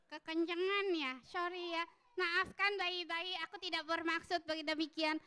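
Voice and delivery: high-pitched puppet saying sorry; apologetic puppet. Acted. Puppet begging the babies' pardon after shouting, high apologetic voice.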